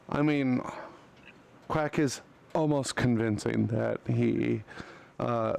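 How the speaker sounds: ordinary talk, nothing marked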